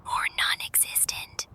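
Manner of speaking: unvoiced whispered jab; whispering. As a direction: whisper it under your breath. Muttered retort under breath.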